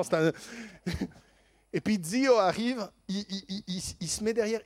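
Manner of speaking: ordinary talk, nothing marked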